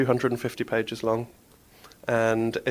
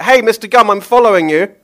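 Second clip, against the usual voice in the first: much higher, very loud yell; 10 semitones higher, 13 dB louder than usual. Acted. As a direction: shout after him down the street. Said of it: "not to yell out things like".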